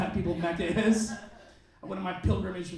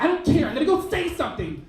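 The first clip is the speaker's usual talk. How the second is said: mock angry girl; 7 semitones higher, 5 dB louder than usual. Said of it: "She's so angry".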